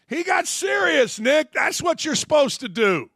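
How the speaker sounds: high shout of conviction; shouted emphasis. Real, not acted. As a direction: belt it to drive the point home. Hammering the point to Nick.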